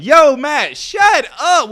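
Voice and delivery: loud, high mock viewer heckle; viewer heckling. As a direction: shout him down as an annoyed viewer. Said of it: "I know what you're sitting there saying".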